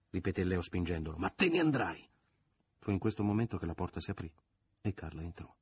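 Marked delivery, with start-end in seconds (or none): 1.2-2.1 higher-pitched emphatic order; angry insistence. A narrator's reading. Man insists while pushing. (insistenza rabbiosa, voce acuta)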